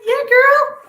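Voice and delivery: high and loud, cheering a friend on; loud squeal of delight for a fellow Capricorn; excited cheer. Real, not acted.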